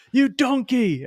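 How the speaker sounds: high yelled insult, imitating Ramsay; Gordon Ramsay insult. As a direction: bark it like a furious chef. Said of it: "he'll call 'em".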